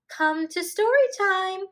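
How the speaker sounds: high, loud call across the classroom; teacher calling out. A narrator's reading. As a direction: call the children over to story time. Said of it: "The teacher called".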